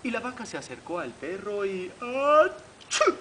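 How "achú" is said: big rising build-up into a loud sneeze; storybook achoo; mock sneeze. A narrator's reading. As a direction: fake a loud, high sneeze. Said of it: "Le estornudó en la oreja".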